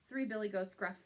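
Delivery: ordinary talk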